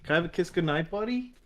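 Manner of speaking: sweet voice for a little son. Real, not acted. Father greets toddler son.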